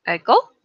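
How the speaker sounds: "Bagel." said with a lift at the end; a single "Bagel." with a rising tone; word tune demo on "Bagel". Shown as a demonstration. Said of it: "so tones really matters".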